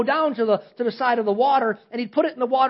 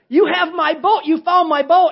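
put-on higher and louder, an excited child's cry; a boy's excited shout, higher and louder; 7 semitones higher, 7 dB louder than usual. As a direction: burst out like a kid who just found his treasure. Boy finds his lost boat.

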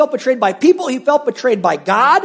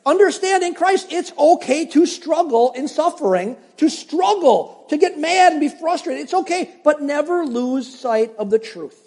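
preaching at full force; 5 semitones higher than usual. Real, not acted. Preaching point, raised voice.

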